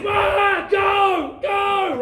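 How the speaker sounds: high, loud shooing yells; scaring shout. Retold or imitated. Dad yelling at the cats in his garden, high loud shouts. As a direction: imitate a frantic shoo, full volume. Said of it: "to scare the cats off".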